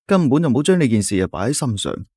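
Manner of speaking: ordinary delivery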